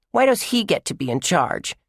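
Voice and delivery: put-on high whiny child voice; whiny little girl. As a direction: complain like a bratty little sister. Little sister protests being left out.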